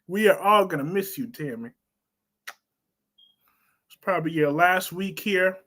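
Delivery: ordinary delivery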